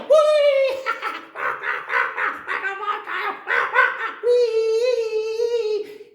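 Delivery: very high, loud squeals with laughing; squealing parrot. Acted. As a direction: shriek while being whirled. Parrot swung by tail.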